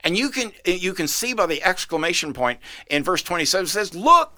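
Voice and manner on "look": a sharp, loud, much higher "Look"; exclaimed. A narrator's reading. Healed man exclaiming at the Pharisees, loud and high. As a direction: burst out with "Look" to stop them. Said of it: "the man exclaimed".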